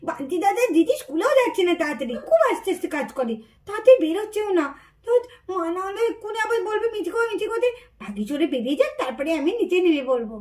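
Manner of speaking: soft, hidden muttering; hushed to herself. Acted. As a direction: keep it low so nobody hears. Hiding child talks to herself. (লুকিয়ে ফিসফিস করে নিজের মনে বলা)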